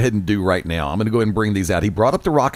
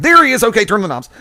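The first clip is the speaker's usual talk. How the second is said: excited; 8 semitones higher, 5 dB louder than usual. Retold or imitated. Mocking his own reaction.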